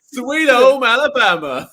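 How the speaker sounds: loud, high, drawn-out Southern twang; hammed-up Alabama accent. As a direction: belt it out as a mock Southerner. Friend joins in mocking Southern accent.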